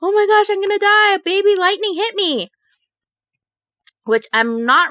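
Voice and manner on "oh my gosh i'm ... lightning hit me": high, frantic replay of her own panic; panicked. Retold or imitated. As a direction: squeal it like you think you're dying. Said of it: "I was like".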